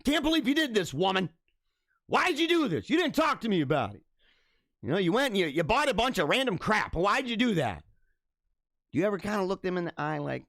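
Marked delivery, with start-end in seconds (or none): 0.1-7.8 hammed-up angry husband, pitch jumping high; mock upset husband. Streamer acting out husband mad over random purchases, mock upset. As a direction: play a husband whining in mock outrage. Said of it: "husband's really mad at you and he's really upset".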